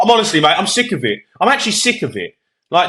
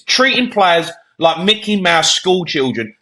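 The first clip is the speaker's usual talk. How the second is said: ordinary talk, nothing marked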